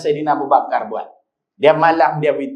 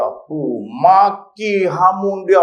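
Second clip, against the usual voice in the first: ordinary delivery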